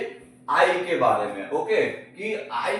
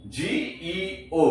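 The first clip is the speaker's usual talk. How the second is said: slow spelling out; much slower than usual. Shown as a demonstration. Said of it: "तो क्या पढ़ेंगे?".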